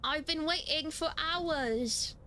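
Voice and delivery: put-on British accent. Character complaining to the player about waiting, in a British accent. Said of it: "this guy's gonna be British".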